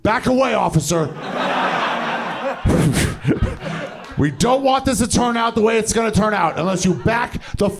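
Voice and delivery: high-pitched, urgent yell at an officer; frantic shout. Retold or imitated. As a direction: shout them away in a panicked high voice. Yells at intruding cop in story.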